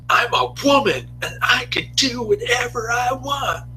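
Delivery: hammed-up, very high-pitched defiant outburst; Alley Tramp. Teenage girl cussing out her parents, hammed-up high defiance. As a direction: wail it in a put-on high voice, full of defiance. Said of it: "when the Alley Tramp says".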